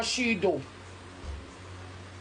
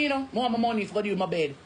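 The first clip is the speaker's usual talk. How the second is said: ordinary delivery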